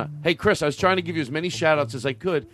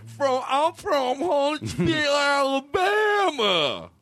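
very high, slow shouted Southern impression; Alabama accent; much slower than usual. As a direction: yell it in a stretched-out Alabama drawl. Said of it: "Do Carl".

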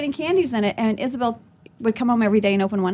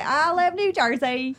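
slow, high, hammed-up accented New Jersey line; joking regional accent, drawn out; mock accent; 6 semitones higher, slower than usual. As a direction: stretch it out in a silly put-on accent.

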